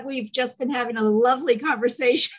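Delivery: ordinary delivery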